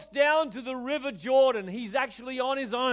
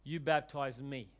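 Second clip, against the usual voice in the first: gentle Jesus reply; 9 semitones lower, 9 dB quieter than usual. Retold or imitated. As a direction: lower and softer, calm refusal. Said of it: "And Jesus goes".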